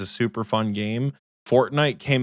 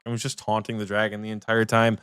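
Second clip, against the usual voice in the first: usual speaking voice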